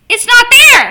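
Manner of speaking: sudden high, loud cry of disbelief; shocked outburst. Real, not acted. Gamer finding the game deleted, shocked loud outcry. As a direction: blurt it out, can't believe it's gone.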